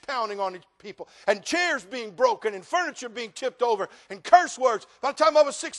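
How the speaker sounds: loud, high, driving preacher voice; full-voiced sermon build; preaching at full force. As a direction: preach it out to the congregation.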